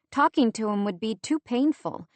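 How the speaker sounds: ordinary delivery, nothing marked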